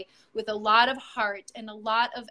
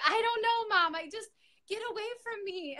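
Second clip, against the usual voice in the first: much higher, imitating an upset daughter; 10 semitones higher than usual. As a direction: voice the daughter pushing mom away, pitched way up. Daughter throwing herself around room.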